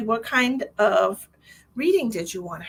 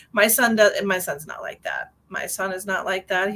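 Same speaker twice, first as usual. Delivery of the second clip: usual speaking voice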